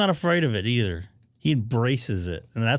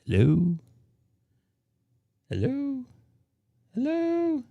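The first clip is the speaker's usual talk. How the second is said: high calling hello; 13 semitones higher, slower than usual. Real, not acted. Testing if anyone hears.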